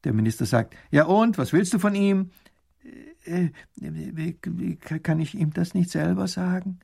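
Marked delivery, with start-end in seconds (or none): 0.9-2.3 higher-pitched, pressing question; impatient questioning. Acted. Minister presses the petitioner. 3.3-6.8 high, hesitant widow; timid stammer. Acted. Timid widow faces stern minister.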